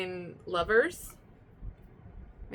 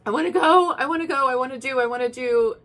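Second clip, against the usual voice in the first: high, loud, eager pleading; mock crying excitement; 5 semitones higher, 8 dB louder than usual. Tearful person overjoyed to go. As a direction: blurt it out near tears with joy. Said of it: "you cry and you're like".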